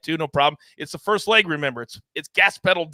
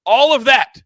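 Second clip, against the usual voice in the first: excited; 9 semitones higher, 9 dB louder than usual. Real, not acted. Hyping the show lineup.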